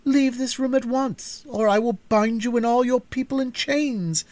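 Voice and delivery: high, loud, imperious outburst; angry princess. A narrator's reading. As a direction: threaten the intruders with chains. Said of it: "cried out".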